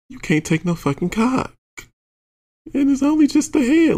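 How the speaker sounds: high-pitched, incredulous telling-off; exasperated rant. Retold or imitated. Irritated teller telling off a squirming partner, exasperated. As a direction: retell the scolding way up high, climbing in disbelief.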